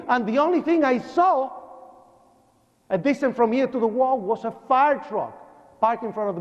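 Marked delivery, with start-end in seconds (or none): none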